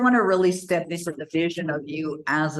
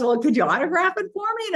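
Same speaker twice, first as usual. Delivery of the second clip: excited request; 14 semitones higher, faster than usual. Retold or imitated. Stranger asks for autograph.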